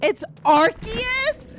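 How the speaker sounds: Arceus as AR-kee-us, drawn out and very high. Real, not acted. Streamer stunned by chat's correction, Arceus as high, slow AR-kee-us. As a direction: stretch Arceus with a hard K, squeaking up in disbelief. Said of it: "it's with a K".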